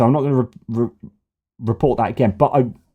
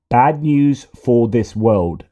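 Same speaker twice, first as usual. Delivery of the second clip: usual speaking voice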